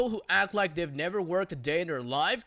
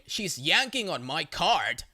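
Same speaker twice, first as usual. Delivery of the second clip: plain reading voice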